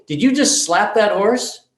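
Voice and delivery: shocked confrontation. Retold or imitated. Therapist confronts horse slapper.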